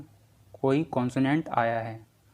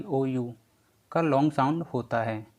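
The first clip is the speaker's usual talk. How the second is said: ordinary delivery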